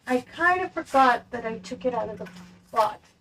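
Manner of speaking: distant, off-mic speech; talking away from the microphone; far from mic. Real, not acted. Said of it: "apologies if I sound far away from the microphone".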